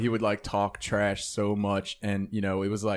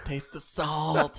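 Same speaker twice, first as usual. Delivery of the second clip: higher, slow, drawn-out call; imitated taunting yell; 5 semitones higher, much slower than usual. Buddy gloating to the other room, mock yelling. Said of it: "yelling back and forth".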